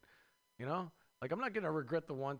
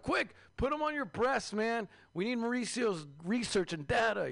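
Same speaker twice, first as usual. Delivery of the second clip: mock urgent order; 7 semitones higher, 4 dB louder than usual. Urgent order about space baby.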